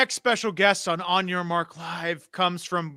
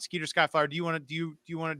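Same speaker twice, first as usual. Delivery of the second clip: normal talking voice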